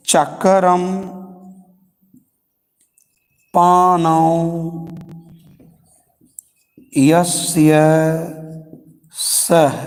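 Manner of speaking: slow pauses between चक्रम, पाणौ, यस्य, सह; teacher segmenting the phrase; word by word. Shown as a demonstration. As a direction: dictate the words apart, then join. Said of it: "इसमें".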